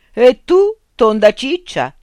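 high, loud mocking comeback; tit-for-tat taunt; retort. A narrator's reading. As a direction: fire the nickname back at her. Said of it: "ribatté Fondovalle".

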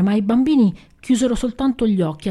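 ordinary delivery

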